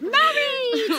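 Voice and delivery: high, long-held cheer of a shout; demonstrated shout. Acted. Wedding guest showing the huddle what to shout, high celebratory shout. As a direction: shout it out high to rally the group. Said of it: "and shout".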